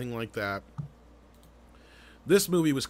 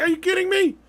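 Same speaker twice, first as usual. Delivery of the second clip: incredulous shout; 8 dB louder than usual. Retold or imitated. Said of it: "So I was like".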